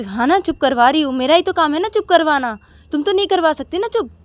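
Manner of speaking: mock him, only she can do it; wife taunts husband about quieting the baby, sarcastic. Sakshi sarcastic in quarrel.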